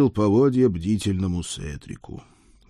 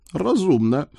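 high-pitched horse confirming; talking horse agreeing; 8 semitones higher than usual. A narrator's reading. Four-legged friend agrees with his rider, high horse voice. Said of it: "подтвердил мой четвероногий друг".

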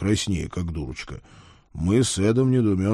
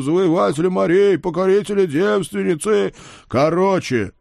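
loud, raised herald's proclamation of titles; pompous demand; 8 semitones higher, 4 dB louder than usual. Acted. Father demanding the konung's full titles, loud and pompous. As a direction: boom out the long list of titles. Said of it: "потребовал я".

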